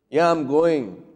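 a curt, put-out "Yeah, I'm going" dragging the "Yeah"; annoyed reply. Shown as a demonstration. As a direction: answer a sharp tone with a sharp one. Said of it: "how is my tone of voice?".

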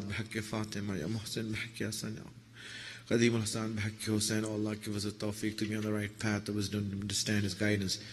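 low, hushed prayer recitation; soft, rapid closing supplication. Real, not acted.

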